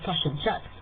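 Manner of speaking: New Zealand "tosh and chips", short flat vowels; Kiwi accent. Shown as a demonstration. Teaching viewers the Kiwi way, Kiwi accent. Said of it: "We also say".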